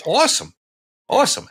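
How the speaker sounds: repeated with a big jump up in pitch; excited, high-pitched awesome. Real, not acted. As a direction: cheer the word awesome, high.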